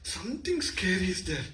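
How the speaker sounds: high, hushed frightened voice; acted fear. Shown as a demonstration. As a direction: shrink from danger, fading to a held breath. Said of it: "That is the Bhayanaka".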